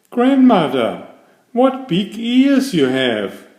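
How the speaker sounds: put-on a child's startled cry at grandmother's ears; exclaiming in surprise. A narrator's reading.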